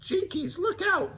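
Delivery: high warning call; lightly voiced Velma; Velma hollering. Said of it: "Velma hollered".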